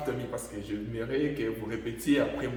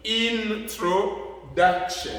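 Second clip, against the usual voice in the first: INTRODUCTION very slowly, higher on "duc"; raised stressed syllable; 6 semitones higher, 4 dB louder, much slower than usual. Shown as a demonstration. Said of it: "je monte un peu ici".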